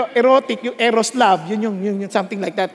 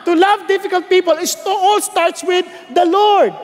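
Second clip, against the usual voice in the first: full-force preaching; 7 semitones higher than usual. Acted. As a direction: drive it home from the pulpit. Preaching climax.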